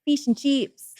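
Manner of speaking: a hint of an Australian accent on "Fish and chips"; lightly mock the Australian vowels; teasing the Australians with "Fish and chips". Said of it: "the Australian accent".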